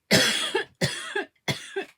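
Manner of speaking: sudden loud cough. Real, not acted. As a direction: cough hard. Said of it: "Excuse me".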